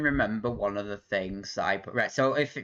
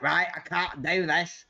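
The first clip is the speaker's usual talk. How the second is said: higher, put-on exasperated voice; mock exasperation; 9 semitones higher than usual. Streamer voicing the dropping internet, mock exasperated. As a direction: give up in mock frustration, pitched up.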